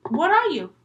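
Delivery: high, loud demand; demanding girl's question. Acted. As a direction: ask it high and loud, wanting an answer. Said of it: "And then she goes".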